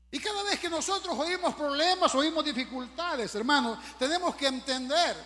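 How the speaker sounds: loud, high fervent preaching. Acted. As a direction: preach it out with fire. Preaching at full force.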